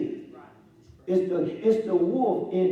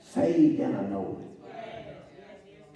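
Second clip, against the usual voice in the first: very slow, stretched declaration; drawn out; 4 semitones lower, much slower than usual. Acted. Preacher declaring his salvation, very slow. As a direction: stretch every word slowly.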